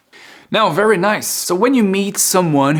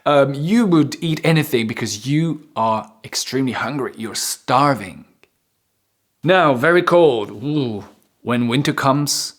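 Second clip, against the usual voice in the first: ordinary delivery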